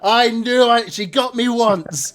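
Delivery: loud, high crowing; gleeful shout of victory; triumphant boast. Acted. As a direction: crow about the win, delighted. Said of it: "he's like".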